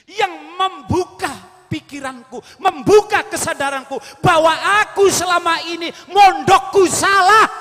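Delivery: high, loud building shout to the last word; preaching at full force. Acted. Preacher shouting Ghazali's realisation to the congregation. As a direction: drive it home like a revelation.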